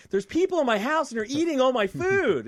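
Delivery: high, loud mock-outraged dictator; hammed-up complaint in a high, loud caricature voice; Kim Jong-un. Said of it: "act like we're Kim Jong-un and go like".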